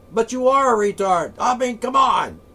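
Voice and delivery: loud rant. Real, not acted. Ranting insult, raised voice.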